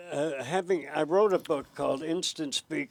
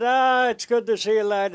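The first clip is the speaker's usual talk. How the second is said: lightly voiced hearty policeman, a bit louder; jovial Irish sergeant; 6 semitones higher, 8 dB louder than usual. Sergeant welcoming the host, lightly hearty. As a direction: greet him like a friendly old cop. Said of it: "He said".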